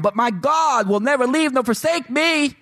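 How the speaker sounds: high, ringing declaration; triumphant climax. Acted. Pastor ending the let-down list, ringing high. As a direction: soar on the promise.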